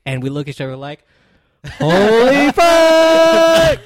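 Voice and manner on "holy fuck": long, high, full-volume scream of awe; hammed-up ecstatic holler; amazed yell. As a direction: stretch it into a huge mock shout. Reenacting their amazed reaction, yelled.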